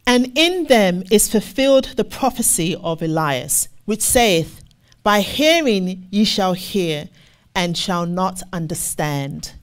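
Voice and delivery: slow, weighty scripture read. Acted. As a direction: lean into each word of the verse. Reads scripture slowly.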